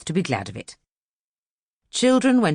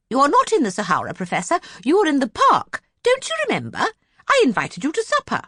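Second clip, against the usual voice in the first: much higher, fussy society lady; 10 semitones higher, faster than usual. Acted. Miss Lark scolding the late Professor, high and fussy. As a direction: chide him sweetly, high and fussing.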